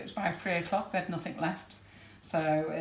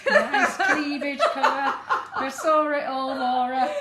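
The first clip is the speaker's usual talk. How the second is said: laughing; 5 semitones higher, 6 dB louder, slower than usual. Real, not acted. Teasing Laura about her dress.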